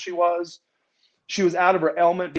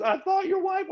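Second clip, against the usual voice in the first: lightly voiced woman, much higher; mock frightened Goldilocks; 4 dB quieter than usual. Goldilocks stammering to him after the fight, high voice. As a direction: pitch way up as the scared wrestler.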